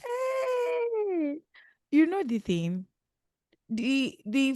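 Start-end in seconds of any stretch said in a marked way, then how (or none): 0.0-1.4 long, high-pitched "hey" squeal. Real, not acted. Co-host reacting to the juicy story, high squeal. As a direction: let out a drawn-out high cry.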